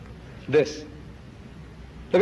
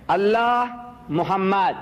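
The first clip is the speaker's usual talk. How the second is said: slow, drawn-out reading of a written phrase; reading out slowly; slower than usual. Retold or imitated. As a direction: read each word out slowly, letting it stretch. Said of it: "I'm reading".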